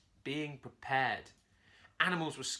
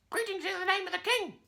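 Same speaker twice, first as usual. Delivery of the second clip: very high squawky parrot parroting; 7 dB louder than usual. A narrator's reading. Parrot mockingly repeats Curly's greeting, very high and squawky. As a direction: mimic it back like a parrot. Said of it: "the parrot replied".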